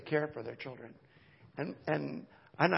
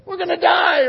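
high, loud mock panic; panicked mother; 16 dB louder, slower than usual. Mother panicking over a fallen baby, high loud mock alarm. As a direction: shriek it like a frightened mom. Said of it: "imagine what would happen if Chelsea said".

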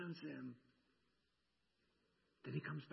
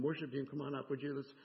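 ordinary talk, nothing marked

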